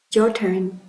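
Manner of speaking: usual speaking voice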